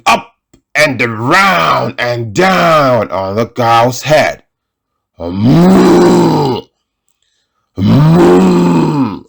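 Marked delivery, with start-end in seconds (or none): 5.2-9.2 cow moos. Acted. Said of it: "said the cow".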